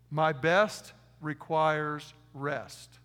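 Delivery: very slow, spaced "My best requires rest"; slow dictation. Shown as a demonstration. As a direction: dictate it slowly, word by word. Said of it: "Write this down".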